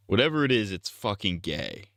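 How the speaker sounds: ordinary delivery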